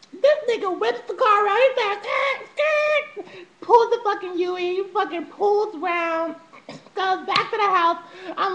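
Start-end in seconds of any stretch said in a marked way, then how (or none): 2.0-3.5 high-pitched mock tire squeal; imitated tire screech. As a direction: squeal like skidding tires. Imitates tires of car whipping around.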